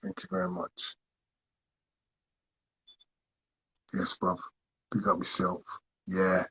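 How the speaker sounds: faint, away from the mic; speaking off-mic while passing the phone; hand over the phone. Real, not acted.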